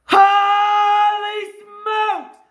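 real jubilant yelling, vowels stretched long; excited shouting. Real, not acted. Scratcher finds a big zero on the ticket, excited shouting.

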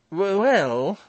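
high, loud stammered start; forced heartiness. A narrator's reading. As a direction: burst out with false cheer. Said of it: "rather a painful affectation of heartiness".

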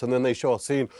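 normal talking voice

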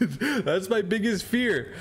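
nervous laugh. Real, not acted. Nervous laugh about fear.

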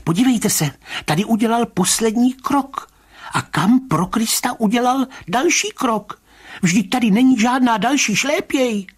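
high-pitched, bewildered protesting; agitated Mr. Rybka. Acted. Rybka showing the commissioner the vanished trail, high agitated voice.

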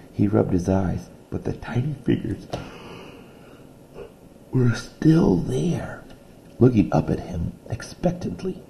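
reading a picture book aloud to a class; steady read-aloud storytelling voice; story narration. A narrator's reading. Teacher reading a story to her class, read-aloud voice.

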